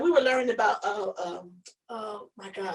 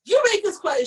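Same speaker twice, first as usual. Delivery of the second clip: higher, louder teacher command; a stern teacher picks a student; teacher calling on me; 8 semitones higher, 7 dB louder than usual. Retold or imitated. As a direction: call on the student firmly. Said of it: "She said".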